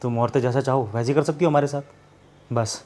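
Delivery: husband, hurt, quietly tells her he's decided; bitter hurt. Acted. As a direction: speak with cold hurt.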